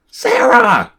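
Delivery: very high-pitched yell; screamed. A narrator's reading. Rachel interrupts her sister on the phone, screaming. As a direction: scream the name to cut through the argument. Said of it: "Rachel screamed into the phone".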